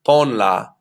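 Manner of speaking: "ponla" stressed on its first syllable. Shown as a demonstration. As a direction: lean on the O in "ponla", letting "la" fall away. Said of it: "that's exactly where I want the stress to be".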